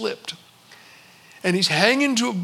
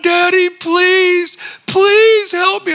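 much higher and louder, slow pleading; begging little boy; 10 semitones higher, 7 dB louder, much slower than usual. Retold or imitated. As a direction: drag out a child's desperate plea. Boy begging his father.

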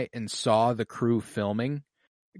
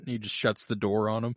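ordinary talk

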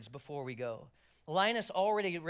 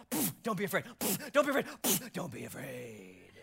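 offhand angel. Retold or imitated. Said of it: "Happens over and over".

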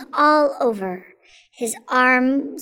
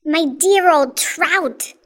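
louder, higher mocking outcry; crying out; 5 semitones higher, 5 dB louder than usual. A narrator's reading. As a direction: cry it out slowly at a rival. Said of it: "Aunt Sponge cried out".

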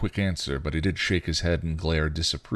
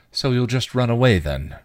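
forced levity; 6 semitones higher, 5 dB louder than usual. A narrator's reading. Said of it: "with forced levity".

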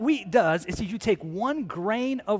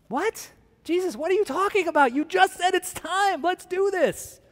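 high-pitched incredulous objection; baffled protest; 10 semitones higher than usual. Acted. Confused disciples objecting to Jesus, high and incredulous.

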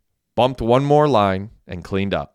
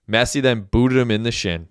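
ordinary delivery, nothing marked

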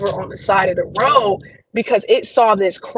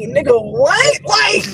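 higher, drawn-up incredulous "what"; acted disbelief; 7 semitones higher, slower than usual. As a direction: replay being stunned by the news cameras. Acting stunned reply to news crews.

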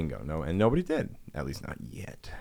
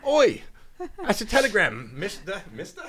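much higher, louder Cockney urchin yelling; cockney messenger; 4 dB louder than usual. Pretend. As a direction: squawk it like a street urchin. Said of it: "And he's like".

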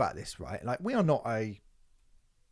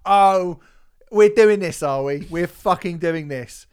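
much louder, indignant outburst; retold rant at the telly; 3 semitones higher, 8 dB louder, slower than usual. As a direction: shout at the TV in disbelief. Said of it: "I fucking shouted at the telly".

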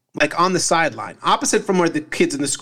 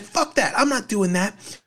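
defiant mom; 6 semitones higher than usual. Retold or imitated. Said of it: "she's like".

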